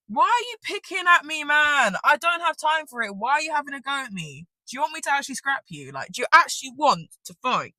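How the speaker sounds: high, loud exasperated outburst; retold angry rant at a teacher; indignant confrontation.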